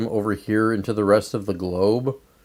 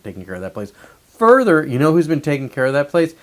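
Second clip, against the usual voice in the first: normal talking voice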